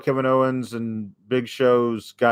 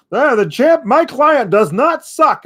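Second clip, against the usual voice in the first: much higher, louder hammed-up hype-man voice; mock Heyman; 11 semitones higher, 6 dB louder than usual. Imitating Heyman defending his client to the crowd, hammed-up. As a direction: puff up like a smug manager defending his client.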